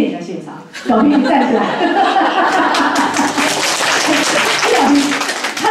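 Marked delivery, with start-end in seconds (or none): 1.7-4.0 a breathy laugh at the classmate; amused laugh. Real, not acted. Laughing as she calls her classmate to stand. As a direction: laugh warmly at your friend.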